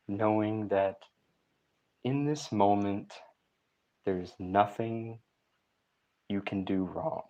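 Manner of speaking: unhurried, calming relaxation coach; guided meditation. Real, not acted.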